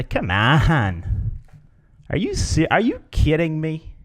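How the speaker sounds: drawn-out come on, then high-pitched disbelief; mock someone for caring, climbing high; exasperated ridicule. Shown as a demonstration. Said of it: "that's kinda ridiculous that you care".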